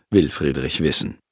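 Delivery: plain reading voice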